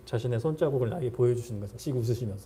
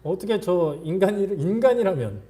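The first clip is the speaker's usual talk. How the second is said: high, louder incredulous complaint; venting to God; 6 semitones higher, 6 dB louder than usual. Acted. Believer pouring out complaints to God about someone, indignant. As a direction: protest in rising disbelief, pitched up. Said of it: "라고 그냥 다 얘기하십시오".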